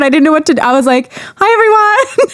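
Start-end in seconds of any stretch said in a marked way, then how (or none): 1.4-2.3 much higher, self-mocking awkward hello; awkward MC greeting. Planner recalling her first MC attempt, awkward high-pitched greeting. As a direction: imitate yourself nervously greeting a crowd in a high voice. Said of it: "I was so awkward".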